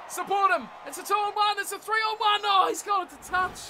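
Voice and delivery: loud, high excited yelling; yelling from the sidelines. Real, not acted. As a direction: scream them on toward the line. Gamer yelling during a breakaway.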